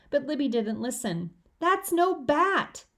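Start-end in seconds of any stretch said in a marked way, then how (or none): 1.6-2.9 high, drawn-out yell, slow. A narrator's reading. Peter chasing his sister, yelling that it's no bat: high yell. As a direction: yell it while chasing her. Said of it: "I yelled".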